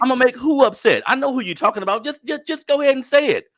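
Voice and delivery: high, loud, sassy dare; mock challenge. Daring critics of her videos, high loud sassy challenge. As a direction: dare them to say it to your face.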